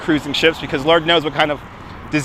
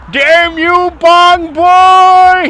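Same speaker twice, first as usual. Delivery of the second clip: high, loud mock-outraged cry; detective's angry catchphrase; 12 semitones higher, 6 dB louder, much slower than usual. Quoting the TV detective, a hammed-up outraged yell. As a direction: shake a fist at Bong Boy.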